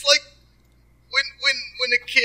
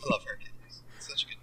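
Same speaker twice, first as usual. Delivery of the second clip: whisper; 4 semitones lower, 7 dB quieter than usual. Pretend. Whispered fan gushing.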